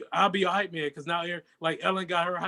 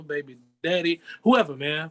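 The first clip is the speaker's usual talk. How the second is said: ordinary talk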